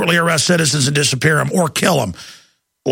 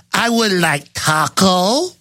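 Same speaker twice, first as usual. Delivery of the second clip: slow, stilted mock restaurant order; condescending diner; much slower than usual. Imitating family ordering at a Mexican restaurant, slow and condescending. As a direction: imitate a patronizing customer, word by drawn-out word. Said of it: "I've got some family that does that".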